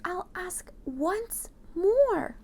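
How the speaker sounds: high, slow plea; mayor pleading at the door. Acted. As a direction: beg politely, drawn out. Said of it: "The mayor pleaded".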